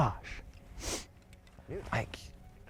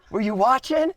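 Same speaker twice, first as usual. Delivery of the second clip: excited; 11 semitones higher, 6 dB louder than usual. Real, not acted. Celebrating the kill with his son.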